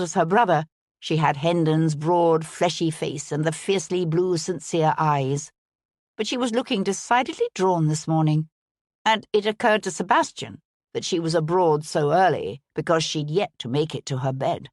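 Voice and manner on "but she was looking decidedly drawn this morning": usual speaking voice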